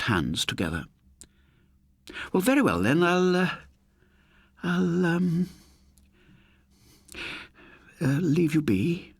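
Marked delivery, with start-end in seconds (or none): 2.3-9.1 flustered muttering. Acted. Landlady backs out of the lodger's room, flustered and hesitant. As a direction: stumble over the words, unsure how to leave. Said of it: "she finally muttered".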